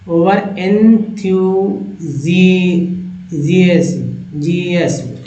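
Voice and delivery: ओवर एंथ्यूजियाज्म drawn out syllable by syllable; very slow demo. Shown as a demonstration. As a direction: stretch each sound of एंथ्यूजियाज्म for the class. Teacher stretches a hard word. (बहुत धीरे, खींचकर उच्चारण)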